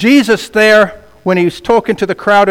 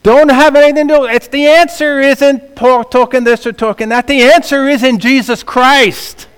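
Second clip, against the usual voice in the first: loud, high, driving sermon; preaching at full force; 7 semitones higher, 4 dB louder than usual. Real, not acted. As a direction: hammer the point home.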